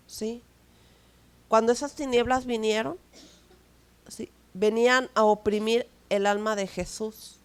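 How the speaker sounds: normal talking voice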